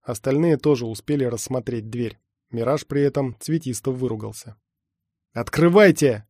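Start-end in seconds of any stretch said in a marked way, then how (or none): 5.3-6.2 loud commanding bark; barked order. A narrator's reading. Everest orders the team to open the door, barked order. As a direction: shout the order over gunfire. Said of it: "рявкнул Эверест".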